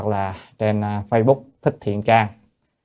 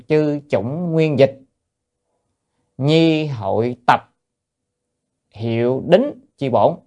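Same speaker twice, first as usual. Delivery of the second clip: slow, drawn-out recitation, pitched higher; formal text reading; 4 semitones higher, much slower than usual. Acted. Teacher reading a classical sutra gloss to students, slow formal recitation. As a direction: read the scripture out word by word, slowly.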